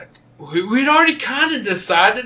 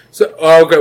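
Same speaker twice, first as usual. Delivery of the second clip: usual speaking voice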